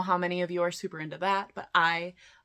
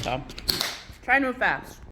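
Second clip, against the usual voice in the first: mouth full; 3 semitones higher than usual. Real, not acted. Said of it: "I have a muffin in my mouth".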